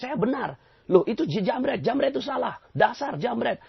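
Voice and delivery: high-pitched indignant protest; self-righteous, high-pitched complaint; indignant blaming. Acted. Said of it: "Tapi kalau kita bilang".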